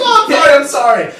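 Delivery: very high, loud, panicked yelp; frantic high-pitched pleading, easing off slightly; cowering gnome apology. Acted. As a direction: squeal an apology like a bullied servant.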